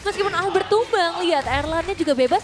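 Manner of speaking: high, loud hyped analysis; excited commentary. Real, not acted. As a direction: ride the hype while explaining. Co-caster hyped over play.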